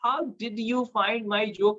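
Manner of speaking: "How did you find my joke?" spelled out word by word, very slowly; slow it right down so a foreigner follows; very slow and clear. Shown as a demonstration. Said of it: "तब बोलेगा कि". (बहुत धीमे, हर शब्द साफ़)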